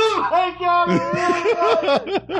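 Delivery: high, laughing through words. Real, not acted. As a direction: giggle through it. Laughing at own reflex.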